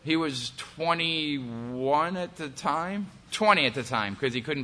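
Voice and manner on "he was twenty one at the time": ordinary delivery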